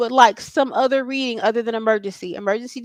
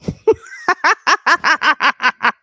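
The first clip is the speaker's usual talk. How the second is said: loud laugh; 5 semitones higher, 9 dB louder than usual. Real, not acted. Reader bursts out laughing.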